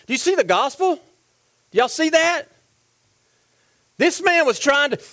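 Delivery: loud, punched sermon delivery; fired-up preaching. Acted. Preacher pressing congregation on the cross, fired-up preaching. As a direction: drive the questions home forcefully.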